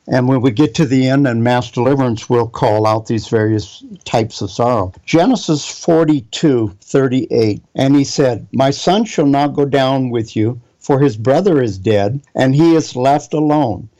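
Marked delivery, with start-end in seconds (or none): none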